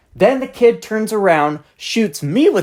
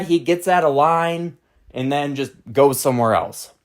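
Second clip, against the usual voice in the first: normal talking voice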